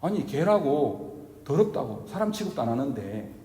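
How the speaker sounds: normal talking voice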